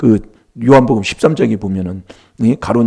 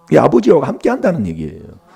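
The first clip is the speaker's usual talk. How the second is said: normal talking voice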